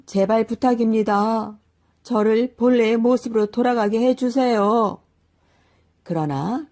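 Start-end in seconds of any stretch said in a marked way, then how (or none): 0.0-5.0 higher, louder desperate plea; the cow-man begging the old man to turn him back; pleading wail. A narrator's reading. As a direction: beg with all your heart. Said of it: "울부짖었습니다".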